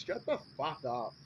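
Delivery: normal talking voice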